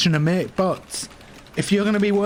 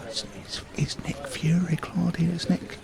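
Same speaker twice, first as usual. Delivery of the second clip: hushed, urgent stage whisper; whispered aside; 2 semitones lower, 7 dB quieter than usual. Acted. Manager tipping off Claudia about Nick Fury, whispered. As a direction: whisper the visitor's name to a colleague.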